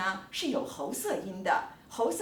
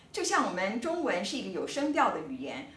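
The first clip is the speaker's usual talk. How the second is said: normal talking voice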